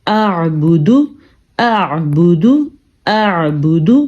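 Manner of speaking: اعبدو repeated slowly, both throat sounds heard; hamza and ain. Shown as a demonstration. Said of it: "دونوں آوازیں آ رہی ہیں".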